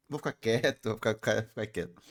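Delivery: low, softened mumble trailing off; self-censoring mutter. Real, not acted. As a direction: back off, repeating it low to yourself. Stops themself from saying something risky. (resmungo baixo, se contendo)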